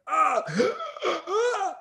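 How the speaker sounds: high, strangled cries of fake pain; faked pain scream. Boy fakes broken ribs to scare attackers, high pained scream. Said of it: "I pretended like my ribs were broken and I couldn't breathe".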